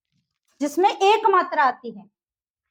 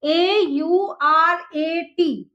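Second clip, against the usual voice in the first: letter by letter, slow; spelled out slowly; much slower than usual. Shown as a demonstration. Teacher spelling a word for students, slow and clear. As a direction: spell each letter out for the class. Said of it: "कैसे लिखेंगे".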